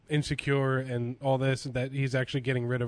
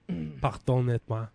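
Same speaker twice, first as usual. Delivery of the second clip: bad French accent; much slower than usual.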